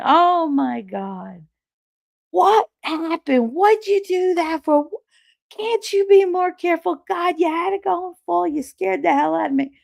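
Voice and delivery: very high-pitched mock scolding; scolding mother. Mother walking in on fallen daughter, mock scolding.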